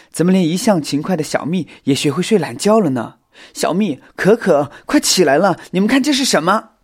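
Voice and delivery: high, loud wake-up call. A narrator's reading. Xiao Xin waking two sleeping friends, loud calling out. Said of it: "小新大声地喊着".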